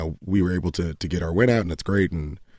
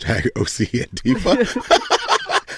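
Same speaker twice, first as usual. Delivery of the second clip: laughing; 5 semitones higher, much slower than usual. Real, not acted. Joking about the Twitter name.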